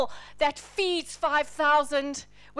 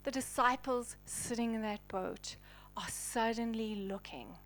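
whispered storytelling, softer and lower; hushed awe; 5 semitones lower, 11 dB quieter than usual. Acted. Preacher to congregation, hushed reverent narration. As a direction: draw them in with a reverent whisper.

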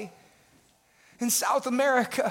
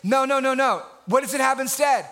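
usual speaking voice

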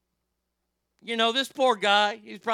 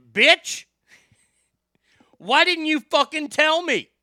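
high, loud outburst of outrage; angry yell; 9 semitones higher, 8 dB louder than usual. Retold or imitated. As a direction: yell it as an accusation. Retold outburst at the office mate.

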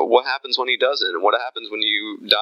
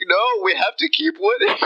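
mock Trump; 12 semitones higher than usual. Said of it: "he'll be like".

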